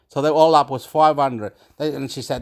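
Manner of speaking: normal talking voice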